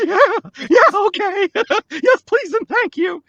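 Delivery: high, loud, laughing acceptance; acted-out squeal of eager agreement; giddy eagerness. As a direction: jump at the offer, voice way up. Retelling eager audition reply.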